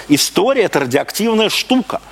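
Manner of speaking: ordinary talk, nothing marked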